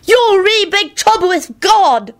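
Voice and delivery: high, drawn-out gleeful taunt; delighted. A narrator's reading. Onlooker teases the puncher's victim, delighted. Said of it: "Nicholas told Kenny delightedly".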